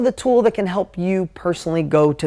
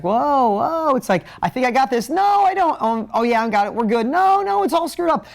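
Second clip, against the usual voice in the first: playful inner voice, pitch leaping high on each no; acting out a flustered entrepreneur flipping between confidence and panic; mock panic; 7 semitones higher than usual.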